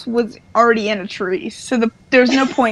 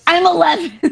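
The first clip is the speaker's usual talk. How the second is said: higher mock-boy protest, laughing; protesting Corey; 5 semitones higher than usual. Podcaster mimicking Corey insisting on his age, higher mock protest. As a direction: lightly play an indignant kid. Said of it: "And Corey's like".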